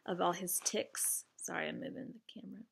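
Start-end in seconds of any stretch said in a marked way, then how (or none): 1.4-2.6 quick unvoiced whisper to the audience; whispered aside. Real, not acted. Reader apologizing for moving the camera, whispering. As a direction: drop to a fast whisper mid-reading. Aside to viewers while reading.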